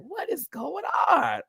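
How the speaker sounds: high, drawn-out gasp of disbelief at the stage; shocked disbelief. Retold or imitated. To viewers, recalling the lip sync, high slow disbelief. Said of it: "I was like".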